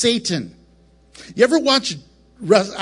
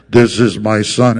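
deep booming loudspeaker voice, much lower and louder; movie God voice; 12 semitones lower, 8 dB louder than usual. Retold or imitated. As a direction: boom it out like a cheesy film God.

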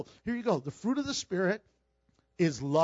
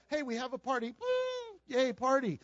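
mock party cheer; 6 semitones higher than usual. Mocking shallow party joy.

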